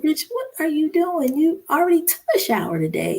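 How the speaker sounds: high-pitched retold disbelief; wife's exasperated query, retold; incredulous questioning. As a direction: question him with voice jumping up. Retold challenge to husband.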